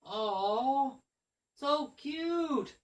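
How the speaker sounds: high-pitched cooing schoolgirls; girls cooing. Retold or imitated. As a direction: imitate a roomful of girls melting over a kitten. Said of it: "the girls always see Lexi and they all go".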